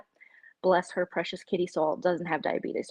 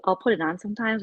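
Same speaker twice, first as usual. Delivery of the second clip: ordinary delivery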